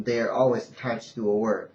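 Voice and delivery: usual speaking voice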